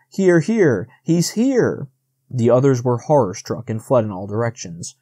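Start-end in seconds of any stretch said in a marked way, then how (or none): 0.1-1.9 high, falling calls; calling out. A narrator's reading. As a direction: call the others over urgently. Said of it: "calling in a deep, loud voice".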